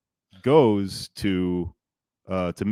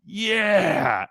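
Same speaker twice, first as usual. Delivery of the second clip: high, drawn-out mock cheer; imitated cheer; 10 semitones higher, 3 dB louder than usual. Someone in the quieted crowd, imitated cheer. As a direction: voice a crowd member suddenly won over. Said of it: "it turns into, like, raucous applause".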